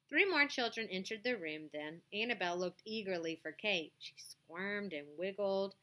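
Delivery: ordinary delivery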